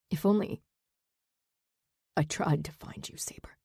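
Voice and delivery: soft raspy whisper. Acted. Zack confessing to Saber in a raspy whisper. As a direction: rasp it barely above a breath. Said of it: "his raspy whisper".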